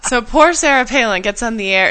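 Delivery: ordinary talk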